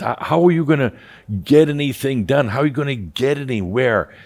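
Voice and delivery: imitating a fretful caller, pitch up; anxious caller.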